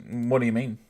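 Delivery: ordinary delivery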